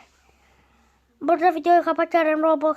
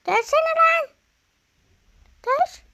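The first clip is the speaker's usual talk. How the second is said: frustrated yell; 12 semitones higher, 3 dB louder than usual. Real, not acted. Avatar won't change back.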